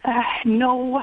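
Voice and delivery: holding back a real cry; tearful. Real, not acted. Grieving caller answers the host, tearful. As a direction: voice catching on tears, real.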